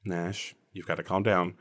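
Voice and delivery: low, soft, patient calming. Acted. Joe talking down a flailing Nash, quiet and calming. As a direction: quietly try to talk them down.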